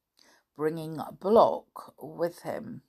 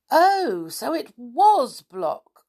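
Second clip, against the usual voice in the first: high, loud exclamation; crying out; 9 semitones higher, 8 dB louder, much slower than usual. A narrator's reading. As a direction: burst out with the discovery, stretched out. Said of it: "cried Sooty".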